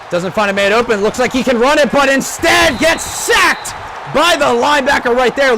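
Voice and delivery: high, loud rising play call; excited shouting call. Acted. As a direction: build to a shout on the sack.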